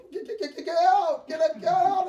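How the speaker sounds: loud, high, stammered panicked yelling; stuttering shout. Retold or imitated. Husband yelling at the cousin to get out, stuttering shout. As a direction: stammer it out as you yell him out. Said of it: "He was stuttering".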